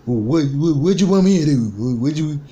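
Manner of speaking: high, loud, indignant uncle voice; upset uncle impression. Vlogger imitating uncle demanding what he should do, loud and high. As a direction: fire the questions back like the cornered uncle. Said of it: "that's exactly how that motherfucker talk when he get upset".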